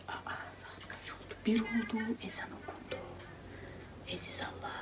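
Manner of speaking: hushed unvoiced whisper. Real, not acted. (fısıltıyla)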